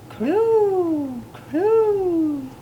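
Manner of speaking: high, long, falling coo; cooing doves. A narrator's reading. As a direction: coo like a dove. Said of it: "The doves cooed".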